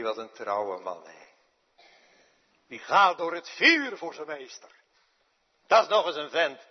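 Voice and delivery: swelling to a loud, ringing cry of praise; admiring exclamation. Retold or imitated. Preacher voicing the crowd's praise of Peter, loud. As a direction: proclaim it loudly like an awed bystander. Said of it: "Dan zeg je".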